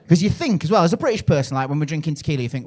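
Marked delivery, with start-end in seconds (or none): none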